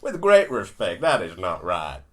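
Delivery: loud, emphatic Australian politician; put-on Australian accent, stretched last phrase. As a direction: push back firmly in an Australian accent. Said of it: "I'm going to do my Australian accent".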